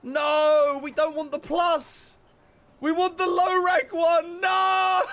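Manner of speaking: high, loud desperate no; shrieking no at the wrong choice; panicked shouting. Real, not acted. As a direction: yell in horror at the mistake.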